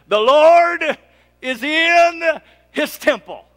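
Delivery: "The Lord" high and loud, then settling; ringing proclamation. Real, not acted. Pastor proclaiming faith in uncertainty, ringing out.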